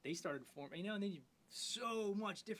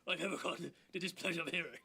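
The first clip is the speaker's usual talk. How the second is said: Churchill impression; 4 dB louder than usual. Friends trading bad impressions, mock Churchill voice. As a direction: growl it like a hammed-up Churchill. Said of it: "that was Churchill".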